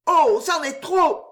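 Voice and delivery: high, loud, drawn-out exclamation; outraged cry. A narrator's reading. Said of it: "s'écria la jeune fille".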